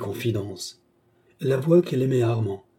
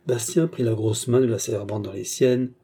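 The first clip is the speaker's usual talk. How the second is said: usual speaking voice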